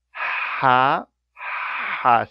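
"ha" with a breathy pharyngeal h, slowly; Arabic ha sound. Shown as a demonstration. Teacher pronouncing the first Arabic letter slowly for learners. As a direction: push "ha" from the throat, slow and airy. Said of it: "See, it's a fricative, continuous breath".

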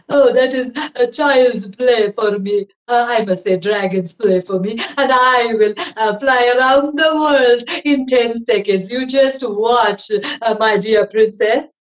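loud, proud dragon voice; boastful dragon. Acted. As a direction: show off like a vain dragon. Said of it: "said the dragon".

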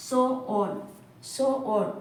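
"Sew on" said as two separate, careful words; pronunciation model. Shown as a demonstration. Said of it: "pronunciation ശ്രദ്ധിക്കുക".

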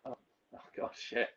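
a hushed oh-no as it sinks in; whispered dismay. Retold or imitated. Narrator realising his blunder after the call, whispered dismay. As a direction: whisper it under your breath, mortified. Realising he didn't know Aitken.

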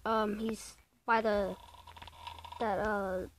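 ordinary talk

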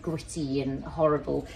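ordinary delivery

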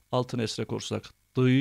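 usual speaking voice

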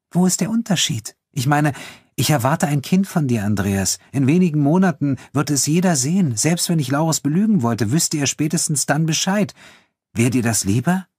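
high, insistent confrontation; Elena, agitated. Acted. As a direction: argue heatedly, pitch kept high. Elena argues heatedly.

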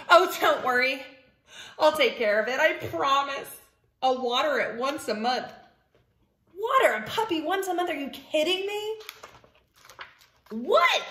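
pigeon character, eager then incredulous. Acted. Pigeon pleading for a puppy, eager then incredulous. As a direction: build from assurance to high disbelief.

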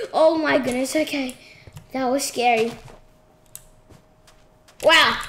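normal talking voice

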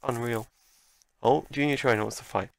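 normal talking voice

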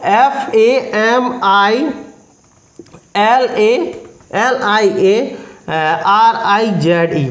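slow letter-by-letter spelling. Shown as a demonstration. Teacher spelling the new word slowly for students. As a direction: read each letter slowly for the class to copy. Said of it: "देख लो".

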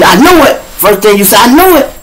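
high, loud dread; startled cry. Retold or imitated. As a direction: flinch at the gunshots. Reacting to hearing shots.

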